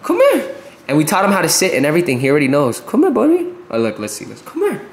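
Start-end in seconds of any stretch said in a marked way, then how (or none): 0.0-0.6 high-pitched "Come here" to the puppy; high dog call. Real, not acted. 2.8-3.5 high coaxing dog call. Real, not acted. 4.4-4.8 high coaxing dog call. Real, not acted.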